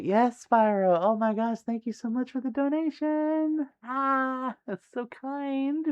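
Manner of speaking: very high, touched gratitude; gushing thanks. Real, not acted. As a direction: gush high, stretching out the last words. Gushing over a donation.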